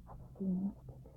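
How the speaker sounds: very soft, awed princess; hushed little echo of amazement; hushed wonder. A narrator's reading. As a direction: breathe it out in quiet wonder. Blind princess wonders at stick.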